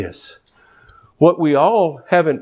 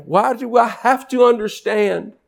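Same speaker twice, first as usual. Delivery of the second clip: preaching at full force; 5 semitones higher than usual. Acted. Preacher urging the grieving congregation, at full force. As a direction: pitch raised, pleading preacher's cry.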